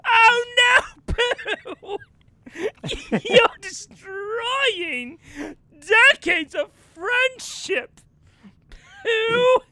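very high, drawn-out theatrical wail; overacted horror. Actor chased by the bear, melodramatic wailing. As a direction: wail each phrase like a ham tragedian. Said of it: "He's like".

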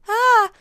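high, loud giddy gasp; a giddy, flustered squeal. Shown as a demonstration. Showing the crush kind of nervous with a high, loud giddy squeal. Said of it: "but more nervous in, like".

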